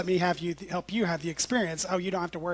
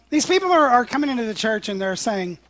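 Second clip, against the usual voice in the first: usual speaking voice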